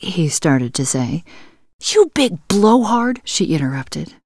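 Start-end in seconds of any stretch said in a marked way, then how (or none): 1.9-3.2 sharp, high insult; angry. Acted. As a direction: snap it at him, furious. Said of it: "Ooh, she was mad".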